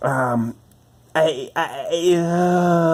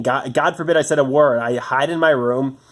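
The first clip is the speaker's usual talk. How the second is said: ordinary delivery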